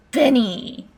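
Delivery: loud, high falling call; summoning shout. Acted. Computer villain singles out next captive, commanding shout. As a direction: shout the victim's name.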